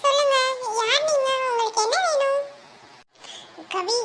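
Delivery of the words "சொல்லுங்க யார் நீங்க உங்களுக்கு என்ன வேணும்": put-on higher, guarded young woman asking who he is; young woman's voice. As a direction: play the wary girl, pitched up. Man voices young woman Kavi. (உயர்ந்த குரலில் எச்சரிக்கையான இளம்பெண்)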